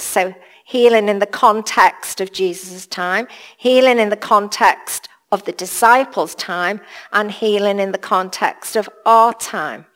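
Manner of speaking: normal talking voice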